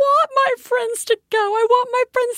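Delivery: mother imitating her daughter's high whine; whiny little girl. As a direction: play the heartbroken kid begging to keep playing. Parent voices daughter's tantrum.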